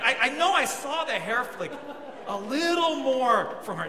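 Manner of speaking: ordinary talk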